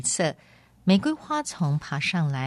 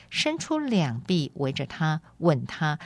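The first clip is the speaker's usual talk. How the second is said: ordinary delivery, nothing marked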